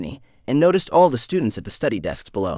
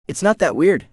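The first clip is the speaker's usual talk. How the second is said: put-on Tennessee accent; 6 semitones higher than usual. A narrator's reading. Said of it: "in his rich small-town Tennessee accent".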